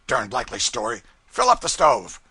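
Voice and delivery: scornful order. A narrator's reading. Brown scoffing.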